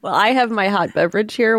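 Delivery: normal talking voice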